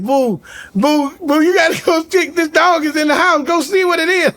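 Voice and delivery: high pleading wife voice; mock wife pleading. Wife begs her husband to check on the dog, high pleading voice. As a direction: coax him to go check.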